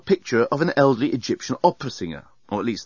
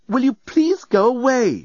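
high, loud, slow and exasperated; 13 semitones higher, 5 dB louder, slower than usual. A narrator's reading. Passenger sends the lamp away, exasperated. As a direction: stretch out the polite request in annoyance.

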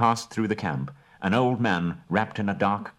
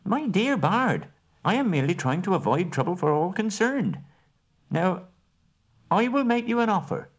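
calm the room, then spring the offer; smooth hobbit dealer; 11 semitones higher than usual. Acted. Bilbo soothing Bard before his offer, high and coaxing.

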